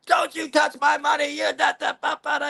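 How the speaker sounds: high, shouted mock rant trailing into gibberish; crackhead yelling. Crackhead at the strip club screaming about his money, high angry yell. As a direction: play the furious crackhead screaming over his dollar. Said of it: "he is kicking up a fuss".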